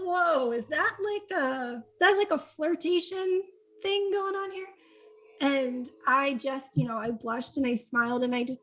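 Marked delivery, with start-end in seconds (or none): 0.0-4.7 mock-surprised, fishing for flirtation; playful surprise. Teasing about flirtation.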